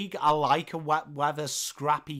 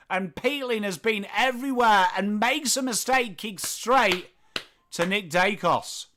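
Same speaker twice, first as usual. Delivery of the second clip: lively, raised-pitch footy commentary; play-by-play; 7 semitones higher, 4 dB louder than usual. Acted. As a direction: keep calling the action with urgency.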